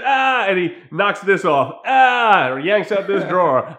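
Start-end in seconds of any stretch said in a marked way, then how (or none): 0.0-0.5 loud angry yell of outrage; enraged yell. Retold or imitated. Johnny smashing things in the house, furious yell. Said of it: "he goes". 1.9-2.6 loud angry yell of outrage; enraged yell. Retold or imitated. Johnny smashing things in the house, furious yell. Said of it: "he goes".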